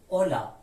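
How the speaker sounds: اولا opening straight on o, no h; silent h. Shown as a demonstration. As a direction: say اولا loudly with the h left silent. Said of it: "هیچ تلفظی نداره". («اولا» بدون صدای ه)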